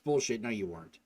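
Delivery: normal talking voice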